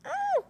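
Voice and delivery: very high shriek; a sudden high shriek of play fright; mock scream. Pretend attack. (صرخة حادة جدًا من خوف تمثيلي)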